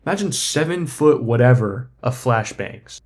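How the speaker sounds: usual speaking voice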